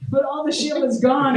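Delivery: ordinary talk, nothing marked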